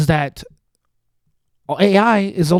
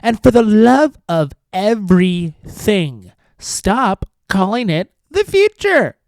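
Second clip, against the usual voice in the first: slow, put-on voice climbing higher; mock Zelda voice; 3 semitones higher, 3 dB louder, slower than usual. Host mocking Zelda's plea to viewers, slow snooty put-on voice. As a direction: lecture slowly in a snooty mocking voice. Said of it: "This is how she sounds".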